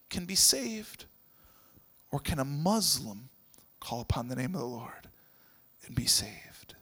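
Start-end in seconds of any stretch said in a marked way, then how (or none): 3.8-6.8 whispered, much softer; a gentle whispered invitation; hushed question. Retold or imitated. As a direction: lean in and whisper it.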